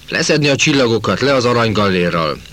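a narrator's contained shout of command; soldier's yell. A narrator's reading. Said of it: "ordította egy katona". (katonás, magas hangú kiáltás)

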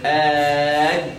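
آد with the closure held long, no breath; stopped dal without breath. Shown as a demonstration. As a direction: hold the d of آد closed. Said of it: "ما تقول دال بتقول إيه".

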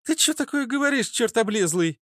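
put-on high-pitched girl's outburst; indignant exclamation. A narrator's reading. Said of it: "воскликнула фитилёк". (возмущённо воскликнуть, высоко)

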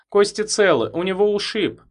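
light female character voice; woman's voice. A narrator's reading. Woman reports from car.

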